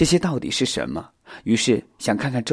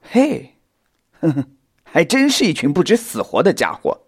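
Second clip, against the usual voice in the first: scoffing snort, then loud taunt; menacing sneer; 6 semitones higher, 6 dB louder than usual. A narrator's reading. Spider mocking the reckless youngsters, menacing sneer. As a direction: sneer at them with swooping pitch.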